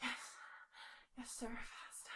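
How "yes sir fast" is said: hushed breathless whisper. Real, not acted. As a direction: answer in a whisper. Whispered reply.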